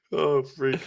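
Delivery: normal talking voice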